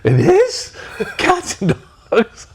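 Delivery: put-on high, slow, amazed child voice, laughing; confused little grandson. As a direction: imitate a puzzled toddler. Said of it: "my grandson goes".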